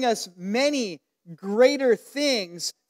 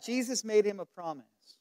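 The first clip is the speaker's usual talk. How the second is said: normal talking voice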